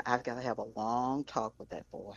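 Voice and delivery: Jed Clampett. Retold or imitated. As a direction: drop low for a folksy reprimand. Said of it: "in the words of Jeff".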